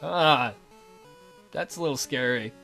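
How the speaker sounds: ordinary delivery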